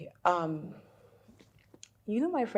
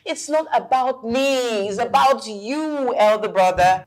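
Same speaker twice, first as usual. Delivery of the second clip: louder, drawn-out exasperated protest; exasperated retort; 5 semitones higher, 6 dB louder than usual. Acted. Sister turning it back on her brother, exasperated. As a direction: throw it back, stretching the words high. Sibling bickering about love lives.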